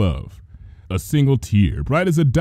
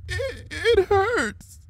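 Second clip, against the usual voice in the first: very slow, soft, broken whimper; whimpering in pain; 6 dB quieter, much slower than usual. A narrator's reading. Injured Trixie at Luna's feet, faint whimper. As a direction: barely get it out through the pain.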